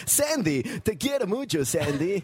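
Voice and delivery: high, slow dubbed movie heartthrob; imitating a pleading Spanish dub; John Travolta impression. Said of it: "John Travolta was like".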